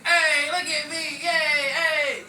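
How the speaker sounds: loud tipsy calling out. Real, not acted. Calling to camera, drunk.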